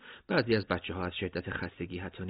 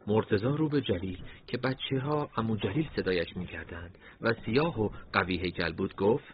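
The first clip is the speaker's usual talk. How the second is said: ordinary delivery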